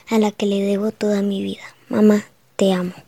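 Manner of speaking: soft loving whisper. Real, not acted. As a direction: whisper it tenderly to mom. Child's tender message to mother.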